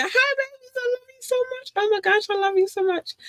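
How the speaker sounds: squeaky high cooing at the fans. Real, not acted. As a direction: coo it up high like to babies. Baby talk to listeners.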